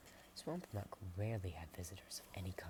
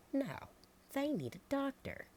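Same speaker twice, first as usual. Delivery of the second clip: much higher and louder objection; insistent farmer; 8 dB louder than usual. Acted. Farmer Sally overruling Pullman about the sick visitors. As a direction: cut in, insisting.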